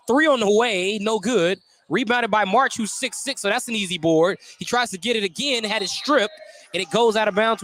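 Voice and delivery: ordinary delivery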